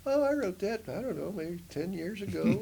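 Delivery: high breezy dismissal; breezy friend. Retold or imitated. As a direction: shrug it off lightly. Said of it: "he's like".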